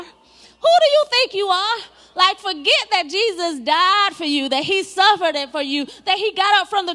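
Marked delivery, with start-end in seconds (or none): 0.0-1.8 higher mock challenge, pushed out; retold challenge. Somebody challenging the believer, higher mock challenge. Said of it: "Has anybody ever said to you".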